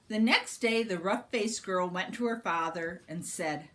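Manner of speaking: ordinary delivery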